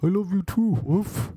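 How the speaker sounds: high, put-on talking-dog voice with a woof. Dog answering its owner's love, silly high dog voice. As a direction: answer as a doting pooch, pitched well up. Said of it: "She did a dog's voice back".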